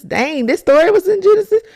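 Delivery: excited disbelief, pitched high; amazed. Retold or imitated. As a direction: build the amazement on the second time. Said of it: "I'm like".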